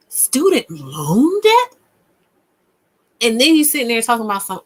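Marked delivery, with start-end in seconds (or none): 0.1-1.8 slow, louder disbelieving rise on debt; slow incredulous echo. Real, not acted. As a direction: drag out the question in disbelief. Incredulous repeat, stronger.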